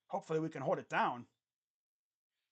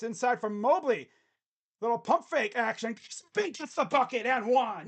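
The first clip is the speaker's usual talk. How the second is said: louder, higher call as the shot drops; excited play-by-play; 4 semitones higher, 7 dB louder than usual. Real, not acted. Commentator calling Mobley's bucket and one, excited. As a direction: punch out the score. Calls a scoring play live.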